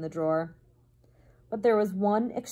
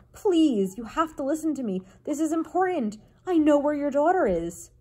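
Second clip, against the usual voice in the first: higher, urgent little voice; pleading mouse; 7 semitones higher, 3 dB louder than usual. A narrator's reading. Mouse begging the king to listen, high urgent plea. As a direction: plead earnestly, pitched up.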